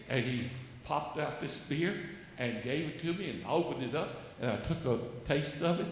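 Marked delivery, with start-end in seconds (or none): none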